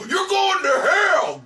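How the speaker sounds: high, slow, booming "you're going to hell"; mock damnation shout. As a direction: condemn them like a fire-and-brimstone preacher. Preacher condemning caller.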